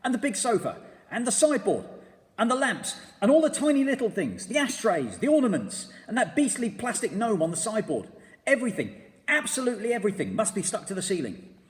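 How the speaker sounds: high, loud excited command; shouted. A narrator's reading. Mugglewump shouting orders to the birds.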